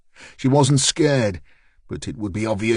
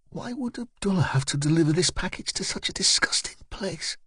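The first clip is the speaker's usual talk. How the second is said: higher, lightly voiced young woman; Aisha's thought; 8 semitones higher, 5 dB quieter than usual. Aisha wondering in a filthy hallway, a lightly voiced higher woman. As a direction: think it in her voice, disgusted.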